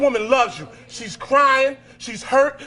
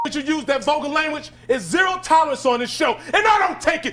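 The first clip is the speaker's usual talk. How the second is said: furious scolding; 4 semitones higher than usual. Real, not acted. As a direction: lay down the law, full force. Scolding defiant teen on show.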